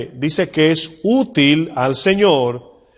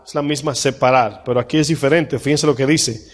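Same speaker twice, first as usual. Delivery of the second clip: ordinary delivery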